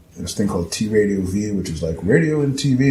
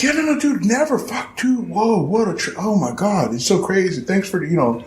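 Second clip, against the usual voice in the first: high, breathless, tumbling over words; excited gushing; 9 semitones higher than usual. Retold or imitated. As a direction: gush like a starstruck friend. Friend gushing over the house news.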